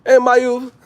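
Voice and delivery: high-pitched Jamaican patois greeting; mock Jamaican accent. Vlogger back native from Jamaica, mock Jamaican accent. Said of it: "Come back native".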